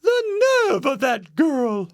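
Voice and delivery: very high-pitched mother bear; indignant Mama Bear. A narrator's reading. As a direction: chime in high and offended. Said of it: "said Mama Bear".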